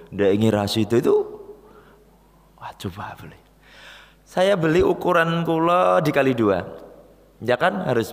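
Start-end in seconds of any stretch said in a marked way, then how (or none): 2.6-3.4 tempted whisper to himself. Retold or imitated. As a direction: breathe it like a secret temptation. Tempted inner thought. (berbisik tergoda)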